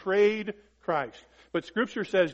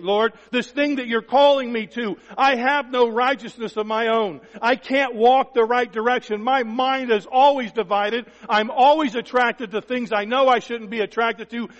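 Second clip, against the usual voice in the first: pleading surrender; 5 semitones higher, 6 dB louder than usual. Acted. Voicing a believer surrendering to God.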